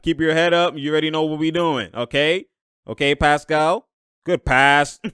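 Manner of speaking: usual speaking voice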